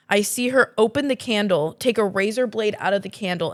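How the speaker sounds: plain reading voice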